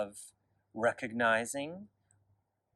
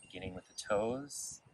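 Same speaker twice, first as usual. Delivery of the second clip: guided meditation; 4 dB quieter than usual. Real, not acted. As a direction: lead gently through the body, unhurried and quiet. Leads a body scan meditation.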